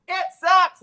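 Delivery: high, loud yell; yelling out the window. Retold or imitated. Boy sabotages the sale from the window, loud yell. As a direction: shout it out to the buyer. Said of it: "I'm yelling out the window".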